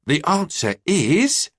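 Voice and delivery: slow, high, teasing drawn-out lead-in; dramatic build-up. A narrator's reading. Boy teasing mum before revealing answer, slow dramatic build-up. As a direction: hold them in suspense. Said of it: "followed by a dramatic pause".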